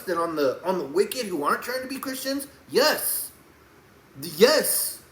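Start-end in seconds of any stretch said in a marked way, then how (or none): 2.7-5.0 high, loud, firm yes; emphatic yes. Real, not acted. Answering whether he is harder on Christians, with an emphatic yes. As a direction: insist on it.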